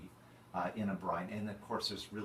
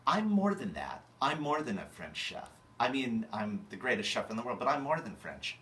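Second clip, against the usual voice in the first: louder, higher brag about being more than French; boastful chef; 4 semitones higher, 6 dB louder than usual. Acted. Chef boasting to the audience, loud and higher. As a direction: puff yourself up, loudly insisting.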